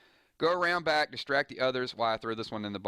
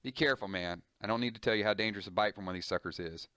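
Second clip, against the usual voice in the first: usual speaking voice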